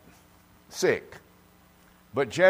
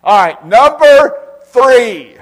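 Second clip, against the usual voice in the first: very high, loud, drawn out; booming announcement; 11 semitones higher, 15 dB louder, much slower than usual. Real, not acted. Preacher to congregation, booming drawn-out announcement. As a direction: call out the point like a herald.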